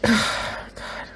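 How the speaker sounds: high, airless sob-like god; a crying exhale of god; tearful breathy god. Real, not acted. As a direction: let it crack into a sigh. Crying over character news.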